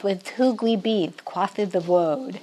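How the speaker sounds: put-on soft, slow lisping child voice, "With who we be, quaffed the woad"; child's lisp. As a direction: imitate a little grandchild's lisp on "woad". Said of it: "they tell it with a lisp".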